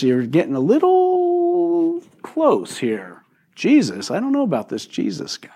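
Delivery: high, slow uneasy voice, stretched out; wary churchgoer voice. Retold or imitated. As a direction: pull back from the name, nervous and drawn out.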